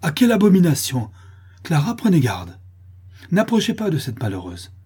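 high, loud warning in disgust; horrified cry. A narrator's reading. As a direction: recoil and warn your daughter off. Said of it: "se recula avec dégoût et horreur ... s'écria". (cri de dégoût et d'horreur)

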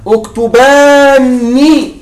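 اكتباني drawn out very slowly as "uktubaanni", long a and doubled n; with alif of the dual. Shown as a demonstration. Teacher slowly spells out the dual command form for students. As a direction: stretch اكتباني syllable by syllable, holding the long a. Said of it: "مع ألف الاثنين".

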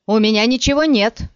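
loud desperate shout. Acted. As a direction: yell it with all his strength. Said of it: "Что было сил, крикнул Яре".

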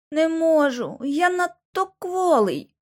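high, drawn-out feeble whine; feeble whining. A narrator's reading. Injured boy tells Lucy he cannot, whining. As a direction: whine it slowly like a weakling. Injured Bobby complains he is weak.

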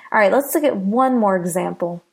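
normal talking voice

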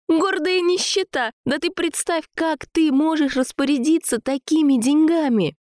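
very high, heated arguing; exasperated quarrelling. A narrator's reading. Sasha argues money with Jacques, high exasperated quarrelling.

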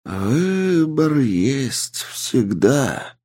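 slow, drawn-out inhuman voice; a patient ancient presence speaking; eldritch horror. Acted. Voice of the inhuman entity. (медленный потусторонний голос)

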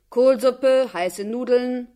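loud vendor's cry; calling out. A narrator's reading. Mother hawking food at the factory gate, loud vendor call. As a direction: hawk the soup to the crowd. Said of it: "rief ein paar Mal".

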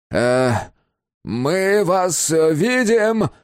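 overdone, high hailing call; slow, stretched-out, high-pitched holler; strained loud announcement. A narrator's reading. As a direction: announce it too loudly, with effort. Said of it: "натужно и чрезмерно громко объявил Безил".

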